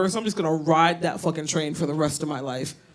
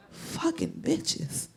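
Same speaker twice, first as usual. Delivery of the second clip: whispered laughing jab; 11 semitones higher, 8 dB quieter, much slower than usual. Real, not acted.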